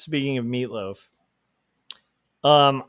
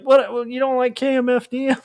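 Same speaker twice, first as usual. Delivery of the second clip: put-on shocked goth kid; 11 semitones higher than usual. Goth kid can't believe Dracula dislikes his bands, high shocked voice. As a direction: squeak out the betrayal. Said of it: "He's like".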